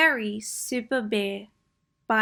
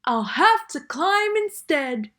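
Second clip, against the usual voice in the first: Bear's voice; 6 semitones higher, 4 dB louder than usual. Acted. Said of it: "yelled Bear".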